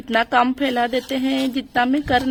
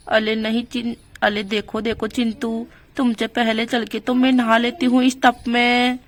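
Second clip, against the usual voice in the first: ordinary delivery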